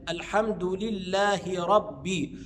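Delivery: very slow "لله" ending in audible hi; clear kasra. Shown as a demonstration. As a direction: sound the i after the h of "لله". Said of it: "أظهر كسرة الهاء". (إظهار كسرة هاء "لله" بتأنٍّ)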